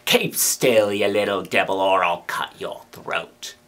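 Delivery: low, menacing growl; lightly voiced convict threat. A narrator's reading. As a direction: threaten the child, dropping low.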